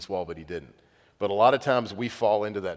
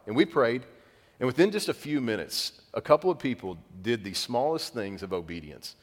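ordinary delivery

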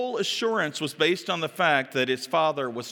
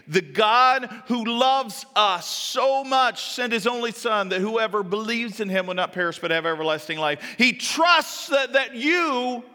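impassioned preaching; 9 semitones higher, 5 dB louder than usual. Real, not acted. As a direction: drive each phrase home to the congregation. Preaches at rising force.

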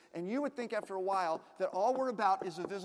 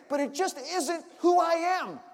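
higher, louder, slow declaration; preacher's slow, loud, higher declaration; emphatic preaching shout; 6 semitones higher, 6 dB louder than usual. Real, not acted. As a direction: proclaim it, slowly and loudly.

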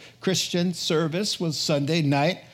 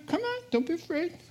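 gentle coaxing; 6 dB quieter, faster than usual. Acted. Jesus coaxing Thomas gently.

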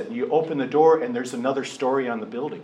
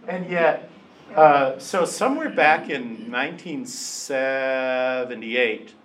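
ordinary talk, nothing marked

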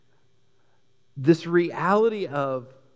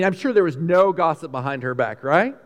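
normal talking voice

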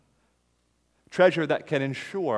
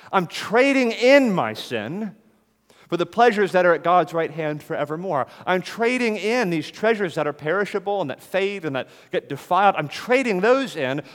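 louder and higher, impassioned; fervent preaching; 5 semitones higher, 6 dB louder than usual. Real, not acted. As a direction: proclaim your trade like testimony. Testimony climax of sermon.

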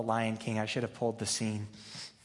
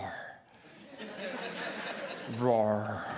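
mock roar; 3 semitones lower than usual. Shown as a demonstration. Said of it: "Did that sound like a roar?".

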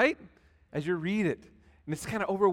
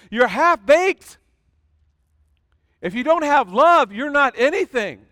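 forceful quote; 6 semitones higher, 7 dB louder than usual. Acted. Paul quoted in a sermon.

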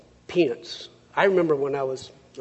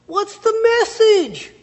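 put-on high, loud, drawn-out Italian accent; imitated Italian accent, pitched way up and loud; strong Italian accent; 9 dB louder, slower than usual. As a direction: exclaim it slowly in a big Italian accent. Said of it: "In a really strong Italian accent".

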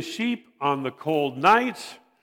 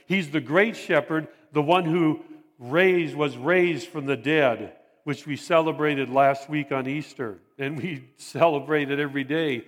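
ordinary talk, nothing marked

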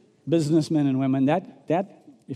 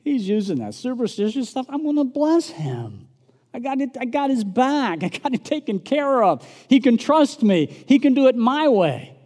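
God's voice, growing bolder and more assured; voicing God; 7 semitones higher than usual. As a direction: start amused, then rise into firm promise. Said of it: "and saying".

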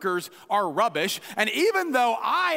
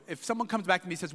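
ordinary talk

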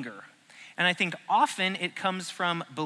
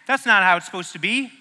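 much higher, louder what the heck; raised, louder pretend anger; mock indignant outburst; 5 semitones higher, 7 dB louder than usual. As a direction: act suddenly offended. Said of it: "You're like".

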